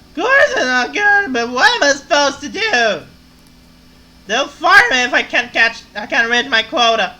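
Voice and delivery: put-on high, loud drunken ranting. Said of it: "to make the drunk voice".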